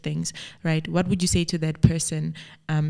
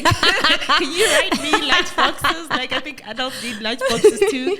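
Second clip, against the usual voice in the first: laughing; 9 semitones higher, 6 dB louder, slower than usual. Real, not acted. Laughing while teasing about lunchboxes.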